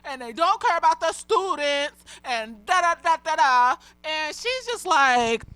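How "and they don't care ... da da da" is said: higher, mock-tearful rant; imitating a teacher's tearful complaint about hoarded resources; imitating upset crying. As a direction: retell her upset outburst in a higher, tearful put-on voice. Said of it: "and she's crying. She's upset".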